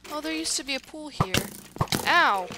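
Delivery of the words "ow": short, loud yelp of being hit. Real, not acted. Gamer hit by an unseen enemy, yelping.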